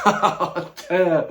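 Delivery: high, squealing laugh breaking out; cracking up at a crude joke; burst of laughter. Real, not acted. Vlogger cracks up reading a rude ballot, high squealing laugh.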